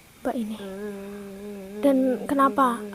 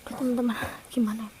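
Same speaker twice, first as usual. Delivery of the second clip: softer whispered helplessness; whispered aside; 9 dB quieter, much faster than usual. Real, not acted. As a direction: murmur it to the viewers, at a loss. (bisikan bingung ke penonton)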